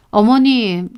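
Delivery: ordinary delivery, nothing marked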